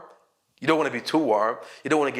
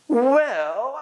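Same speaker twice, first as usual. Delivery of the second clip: "Well" high, loud, long rising; hesitant filler; 9 semitones higher, 3 dB louder than usual. Shown as a demonstration. Answering a student, a high stretched "Well". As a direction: stretch "Well" upward to buy time. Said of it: "you just buying yourself some time".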